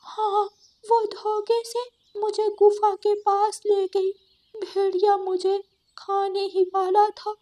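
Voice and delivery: high, shaken voice telling of the escape; frightened goat. Acted. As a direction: relive the fright as you tell it. Goat recounting near escape. (डरी हुई ऊँची आवाज़)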